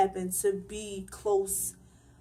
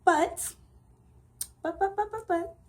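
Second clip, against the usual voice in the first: higher, halting repeated "but"; pitched-up sputtering buts; hesitating buts; 10 semitones higher than usual. Real, not acted. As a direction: stutter toward the revenge plan. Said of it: "I came to my senses".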